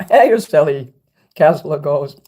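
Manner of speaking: ordinary talk, nothing marked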